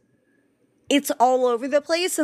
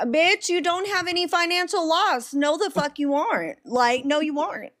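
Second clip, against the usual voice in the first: higher-pitched, exasperated rant; 6 semitones higher than usual. Real, not acted. Co-host answering people who threaten defamation suits, ranting. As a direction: rant back at would-be defamation suers. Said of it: "I'm ranting".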